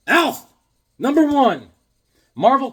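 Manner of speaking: high, loud exclamations; excited shout. Real, not acted. Host showing off Alf comic, excited shouting. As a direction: announce the prize in two bursts. Delighted at gift box find.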